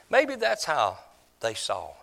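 ordinary talk, nothing marked

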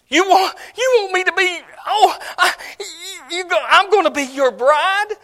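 high, loud, tearful disbelief; overjoyed bride. Acted. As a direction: squeal with tearful joy at the proposal. Bride's excited reply in sermon.

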